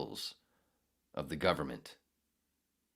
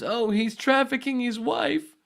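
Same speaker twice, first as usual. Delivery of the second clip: much higher, louder, slow, shrieking mock outrage; mocking a pearl-clutcher; 8 dB louder than usual. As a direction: squeal it like a scandalised prude.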